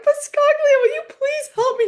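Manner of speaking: high, loud, desperate begging near tears; tearful plea. Retold or imitated. Crying coach begging a colleague for help, high pleading. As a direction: plead like you're bawling and lost.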